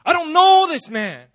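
acted indignant shout; cursing denial. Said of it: "Even cursing".

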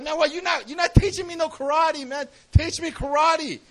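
retold student's impatient protest; frustrated student. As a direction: complain indignantly at your teacher. Student protests to his teacher.

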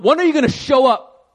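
high, loud demanding voice of an impatient God. Pretend. Imagined God on his throne scolding a believer, impatient demand. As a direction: scold like a fed-up judge on a throne. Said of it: "God's in heaven on his throne going".

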